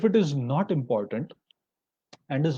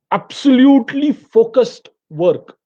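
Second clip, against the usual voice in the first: "absolutely" punched higher and louder, whole phrase slow; strong emphasis; 7 semitones higher, 6 dB louder, slower than usual. Real, not acted. Coach driving home the rule to students, loud slow stress. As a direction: hammer home the idea of total focus. Teacher stressing focused work.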